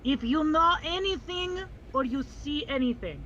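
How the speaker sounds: put-on slow, accented protector voice; solemn protector, investigating. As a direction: question the tavern keeper slowly and gravely. Player plays investigating protector.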